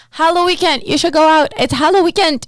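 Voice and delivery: high, loud, pushy hype voice; excited influencer urging you out; hyped TikTok voice. Retold or imitated. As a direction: sell it like an influencer ad. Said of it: "my whole TikTok was like".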